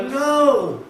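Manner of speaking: high, drawn-out mock protest; retold indignation. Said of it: "he got all mad".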